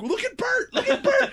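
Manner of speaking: put-on high, loud children taunting; mocking kids. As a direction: squeal it like teasing kids. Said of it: "these kids are like".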